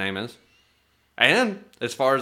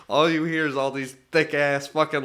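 ordinary talk, nothing marked

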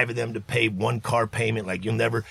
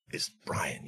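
much lower, unvoiced whisper; whispered correction; 12 semitones lower, 14 dB quieter than usual. [name] weakly correcting [name], in a whisper. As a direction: mutter it under your breath.

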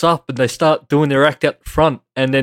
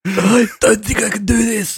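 speaking on inhale; 6 semitones higher than usual. Shown as a demonstration. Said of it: "we have to talk in".

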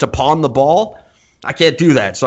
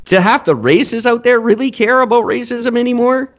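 normal talking voice

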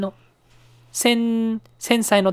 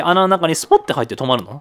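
normal talking voice

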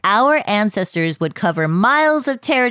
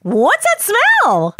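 slow, high, puzzled sniff; suspicious surprise; 11 semitones higher, much slower than usual. Acted. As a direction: drag it out, wrinkling your nose.

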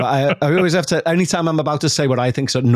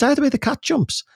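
much higher, incredulous echo; imitating Becca's bafflement; 13 semitones higher than usual. Becca hearing the odd saying, baffled. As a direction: echo the saying in high-pitched puzzlement. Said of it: "She went".

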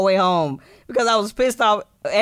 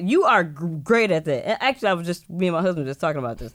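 normal talking voice

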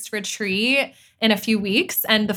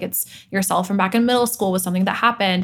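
ordinary talk

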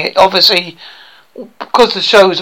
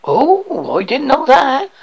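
broad rural British bumpkin, higher voice; eager would-be actor; 7 semitones higher than usual. Acted. Aspiring actor boasts to coach about school play, eager bumpkin voice. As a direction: play a naive hopeful with a thick country accent.

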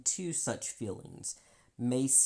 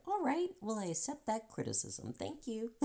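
mock calm acceptance; 11 semitones higher than usual. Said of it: "and they're like".

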